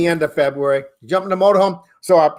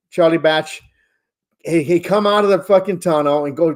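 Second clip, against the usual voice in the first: ordinary talk, nothing marked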